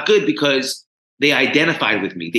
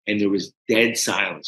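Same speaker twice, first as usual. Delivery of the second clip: usual speaking voice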